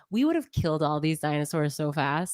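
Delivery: ordinary talk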